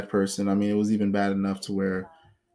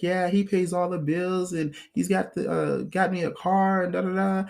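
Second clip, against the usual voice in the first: mock bragging, pitched higher; boastful girl; 7 semitones higher than usual. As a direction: brag like she's got it made. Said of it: "was boasting about it".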